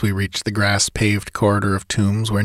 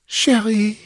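put-on high quivering ghost girl; trembling girl voice; 13 semitones higher than usual. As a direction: let the word tremble. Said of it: "in a trembling voice".

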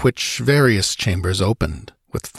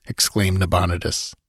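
ordinary delivery, nothing marked

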